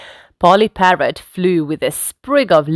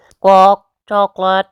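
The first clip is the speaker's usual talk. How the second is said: loud squawk, then mimicking bird; squawk, then repeat like a parrot; Polly Parrot; 5 dB louder than usual. A narrator's reading. Parrot copying the order, squawking bird mimicry. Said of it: "Polly Parrot copied".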